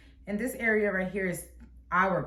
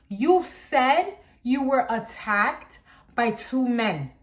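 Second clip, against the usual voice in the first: slow, spaced-out retort, re-enacted; acted exasperated insistence; 3 semitones higher, much slower than usual. Child confronting mom over her words, slow acted exasperation. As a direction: spell it out in mock exasperation.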